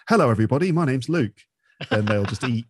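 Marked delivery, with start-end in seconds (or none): 0.1-1.4 higher-pitched, mock stage hello; stand-up comedian's greeting. Comedian like me on stage in Liverpool, mock chirpy greeting. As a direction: greet the crowd in a lifted, sing-out voice. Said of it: "if you are like me and you go up on stage".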